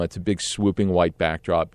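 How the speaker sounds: ordinary delivery, nothing marked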